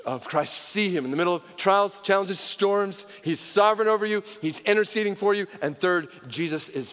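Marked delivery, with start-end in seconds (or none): none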